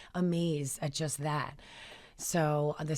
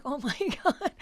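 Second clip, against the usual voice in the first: stunned; 10 semitones higher than usual. Retold or imitated. Stunned by Merry Christmas wish.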